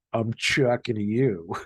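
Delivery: slow Boston "I'm chucking you"; drawn-out Boston accent. Retold or imitated. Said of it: "she used to always say".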